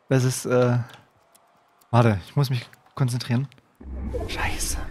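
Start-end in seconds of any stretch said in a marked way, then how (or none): 4.3-4.8 soft voiceless swear; tense curse under your breath; whispered curse. Real, not acted.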